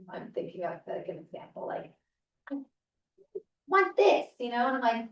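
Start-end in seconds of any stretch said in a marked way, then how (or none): 3.7-4.3 imitating a demanding child, high and raised; mock little-kid demand, pitched way up; demanding preschooler. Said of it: "say that in a different tone of voice".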